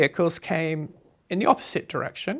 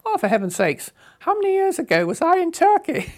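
sharply higher, louder mock exasperation; exasperated; 11 semitones higher, 5 dB louder than usual. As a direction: throw up your hands in disbelief. Exasperated thought about Turkey.

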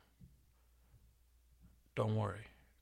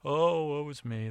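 hammed-up woe; 5 semitones higher, 8 dB louder, slower than usual.

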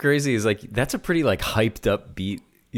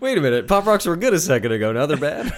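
laughing; 6 semitones higher, faster than usual. Real, not acted. Teasing mock outrage, laughing.

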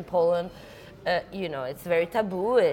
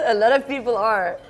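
laughing; 6 semitones higher, 7 dB louder than usual. Real, not acted. Laughs at own remark.

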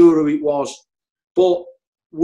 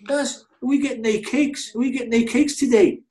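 much higher pitched mock voice of the manager; Moyes impression; 9 semitones higher than usual. Teammate imitates the manager asking for Friday cakes, high mock voice. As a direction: pipe it up high like a cheeky boss asking for cakes. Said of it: "So Moyes would say".

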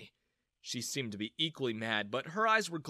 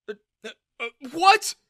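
stammer, then high shouted question; falter, then burst out loudly; dumbfounded; 11 semitones higher, 12 dB louder than usual. A narrator's reading. Father stunned by his daughter's rebuke, dumbfounded.

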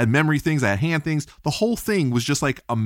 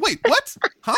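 high-pitched baffled sputter, re-enacted; thrown off. Survivor replaying her reaction to "get over it", thrown off. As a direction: act out stunned confusion in a squeaky-high voice. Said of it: "so thrown off".

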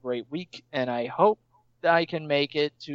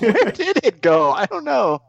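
high-pitched; 8 semitones higher, 6 dB louder than usual. Pretend. Mock echo of confused question.

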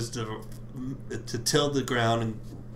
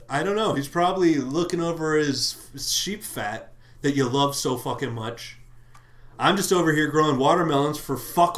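ranting Cain; 3 semitones higher, 4 dB louder than usual. Retold or imitated. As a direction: let Cain blow up at God. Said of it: "He says".

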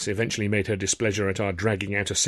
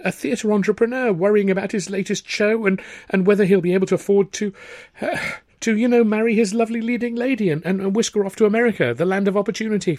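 higher, stumbling improvisation; flustered Arthur; 11 semitones higher, 6 dB louder than usual. A narrator's reading. Arthur to Tilly inventing a story, flustered. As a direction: make it up on the spot.

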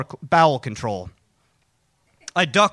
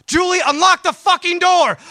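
high-pitched urgent shout through the door; desperate yell; 11 semitones higher, 4 dB louder than usual. Acted. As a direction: holler frantically for your wife to open up. Desperate call to wife at locked door.

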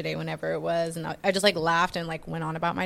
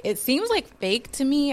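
excited; 8 semitones higher, 4 dB louder than usual. Real, not acted. Shocked at the [name] story.

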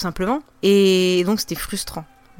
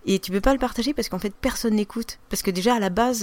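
ordinary talk

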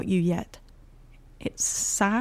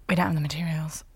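whispering; 5 semitones lower, faster than usual. Acted. Whispered self-talk.

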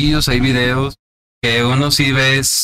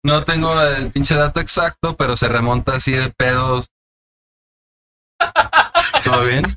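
usual speaking voice

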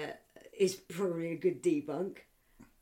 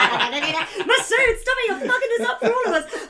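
high, fast, laughing scold; laughing while telling a friend off; 10 dB louder than usual. Real, not acted. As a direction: scold him through laughter.

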